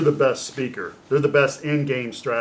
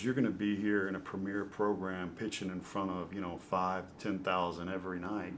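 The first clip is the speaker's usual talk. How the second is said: softer, intent recruiting plea; hushed coach pitch; 3 semitones lower, 10 dB quieter than usual. Retold or imitated. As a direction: lean in and sell it quietly. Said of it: "he goes".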